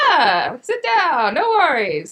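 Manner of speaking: high, loud, drawn-out imitation of a host; mock hearty welcome. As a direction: wave them in with long, swooping vowels. Imitates the restaurant staff welcoming them.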